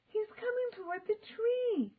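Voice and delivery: high panicked cry; frightened cry. A narrator's reading. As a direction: cry out in fear. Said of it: "Annie cried".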